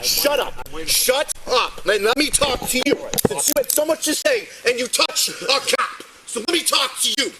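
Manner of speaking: loud order to be silent; real forceful yelling; angry shouting. Real, not acted. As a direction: shout him down so you can speak. Officer shouts over interrupting man.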